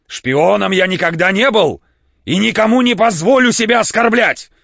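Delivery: высоко и громко, с ударением на «оскорблять»; кричит, надувшись от важности; дребезжащий крик. A narrator's reading. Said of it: "крикнул он дребезжащим голосом". (дребезжащий крик, громко)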